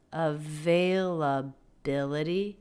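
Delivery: "availability" slow, leaning on "bil"; stretched availability. Shown as a demonstration. Teacher stretching the word so students hear the stress. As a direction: draw the word out and land on "bil". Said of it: "The stressed syllable there".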